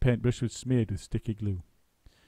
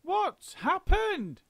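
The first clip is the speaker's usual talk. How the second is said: put-on high, slow, wailing old woman; screamed; much slower than usual. A narrator's reading. Missus Twit panicking at the upside-down room. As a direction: wail it in panic. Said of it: "screamed Missus Twit".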